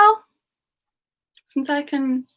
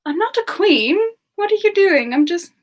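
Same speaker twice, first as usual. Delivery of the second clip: higher, louder amused protest; flustered protest; 6 semitones higher, 6 dB louder than usual. Real, not acted. Streamer protesting to chat that she is not a queen, flustered. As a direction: laugh off the praise, all flustered.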